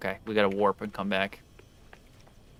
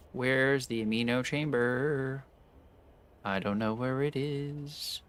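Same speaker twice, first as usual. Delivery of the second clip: slow quiet muttering; 3 semitones higher, 8 dB quieter, slower than usual. Real, not acted. Searching menu, muttering.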